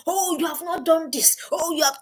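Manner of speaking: a mild mock of a nagging mum; scolding parent. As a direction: imitate a parent finding fault. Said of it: "You now come home".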